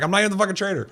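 high-pitched, exasperated retold denial; squeaky-high defensive retort, played for laughs; mock indignant protest. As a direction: protest innocence in a jumped-up high voice. Protesting a false accusation in retelling.

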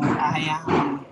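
ordinary talk, nothing marked

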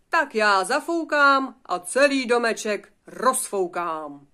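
very loud, slow, stretched-out threat; shouted wolf threat. Acted. Wolf threatening to blow the wooden house down, shouting. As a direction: bellow it slowly, word by word. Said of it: "vykřikl vlk".

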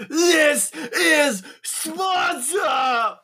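drawn-out, high-pitched war cry; battle cry. Acted. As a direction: bellow it high, stretching every word. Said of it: "and go".

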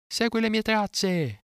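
very high, slow calling voice; crying out. A narrator's reading. Carried-off boy asks the vulture to track him, high drawn-out cry. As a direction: send a long, high call into the sky. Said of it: "gridò Mowgli".

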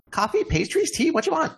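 high, brisk little gnome voice; small, perky gnome server; gnome waitress. Acted. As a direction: take the order in a high-pitched voice. Gnome takes the order.

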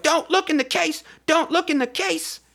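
very high imitated woman's cry; mock desperate plea. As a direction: beg in a hammed-up high voice. Woman begging them not to look.

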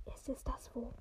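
hushed unvoiced whisper. A narrator's reading. McGonagall whispering to Dumbledore over the baby. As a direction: whisper it like a worried witch. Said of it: "flüsterte Professor McGonagall".

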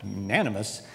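a baffled echo of "Unanimous"; puzzled repeat. Real, not acted. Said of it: "I think they meant anonymous".

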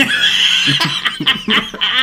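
a sudden high-pitched laugh; high burst of laughter. Real, not acted. Laughing at the joke about the podcast, a high giggle.

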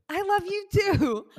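very high, slow sing-song answer; high, stretched, indulgent reply; actress's sweet reply. Retold or imitated. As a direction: mimic a celebrity humouring a fan. Voicing actress's second reply.

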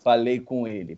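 policial grave e seco; policial ríspido. Retold or imitated. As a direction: corta a namorada, grave e seco. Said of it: "falou assim". (policial dando bronca na namorada, grave e seco)

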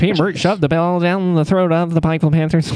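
high, booming sports announcer. Acted. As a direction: call it like a play-by-play announcer. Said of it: "your announcer voice".

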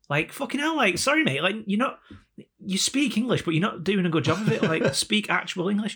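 high-pitched incredulous complaint; mock exasperation. Baffled listener telling the Scot to speak English, mock-exasperated. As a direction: plead in hammed-up bafflement.

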